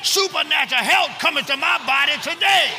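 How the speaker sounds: high, urgent declaration; with conviction. Shown as a demonstration. Preacher urges the congregation to repeat the confession, high and fervent. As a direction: shout it high, rallying the crowd. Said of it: "Say it with some conviction".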